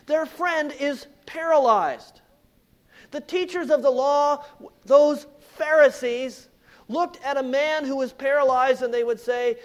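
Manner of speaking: full-force preaching. Acted. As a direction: preach it out to the congregation. Preacher builds with rising force.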